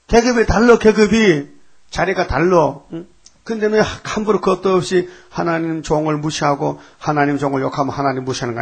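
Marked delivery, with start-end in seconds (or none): 0.1-6.2 loud, higher scolding; God scolding Miriam and Aaron, loud and raised; angry rebuke. Retold or imitated. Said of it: "막 야단쳤어".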